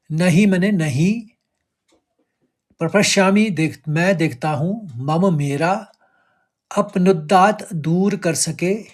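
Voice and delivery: ordinary delivery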